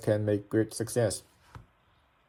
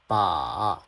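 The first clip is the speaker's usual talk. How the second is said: "ba" dipping low, then back up; third tone; 4 semitones lower than usual. Shown as a demonstration. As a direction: scoop the pitch down and up on "ba". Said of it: "go down, then go up".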